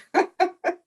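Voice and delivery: high-pitched giggle; short, high chuckle; amused laugh. Real, not acted. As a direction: laugh lightly at the friendly telling-off. Laughs at parting reminder.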